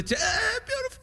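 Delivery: real emotion cracking through; choked up. Real, not acted. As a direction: voice breaking up high. Moved retelling dad crying.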